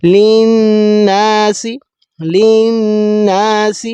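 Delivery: للناس very slow, the double نون ringing in the nose; ghunna. Shown as a demonstration. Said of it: "ناک کے بانسے سے آپ نے آواز کو نکالنا ہے". (للناس میں غنہ)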